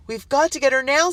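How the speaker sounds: put-on high, loud, urgent girl; girl urging the adults to save her friend. A narrator's reading. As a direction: insist on rescuing her. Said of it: "said Lily".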